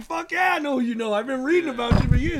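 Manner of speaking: high, loud, eager retold reply; excited fan. Starstruck rapper answers the mogul, gushing loud and high. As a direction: gush it back, pitched well up.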